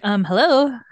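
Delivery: imitating a man sidling up awkwardly; mock awkward greeting. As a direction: play the weird guy butting in. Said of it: "he's just like".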